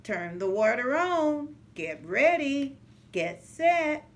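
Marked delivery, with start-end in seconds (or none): none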